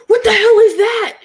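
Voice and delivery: high, loud startled shout. Retold or imitated. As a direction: jolt awake in alarm. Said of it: "Russ jumps up".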